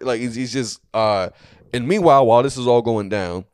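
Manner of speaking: ordinary talk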